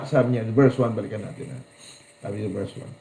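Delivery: ordinary talk, nothing marked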